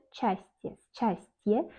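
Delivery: "счастье" read as written, s-CHAS-tye; spelling pronunciation. Shown as a demonstration. Teacher showing how it is written, "счастье" as s-chastye. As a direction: sound the s and ch apart in "счастье". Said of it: "On écrit".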